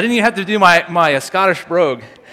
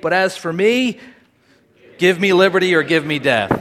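higher, impassioned oratory; dramatic speech reading; 4 semitones higher, 4 dB louder than usual. Acted. Speaker reading Patrick Henry's plea for liberty, impassioned oratory. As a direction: drive toward a defiant declaration.

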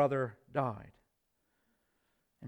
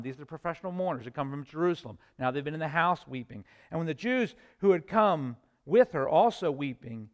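ordinary talk, nothing marked

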